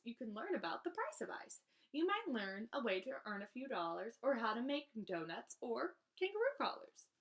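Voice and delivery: usual speaking voice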